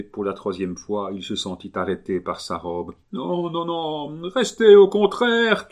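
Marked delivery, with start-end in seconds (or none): 3.1-5.7 aigu, très fort, lent sur la fin; cri de toute sa force. A narrator's reading. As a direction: crier de toutes ses forces. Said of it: "cria le mourant de toute la force de sa voix". (le mourant retient le moine, en criant)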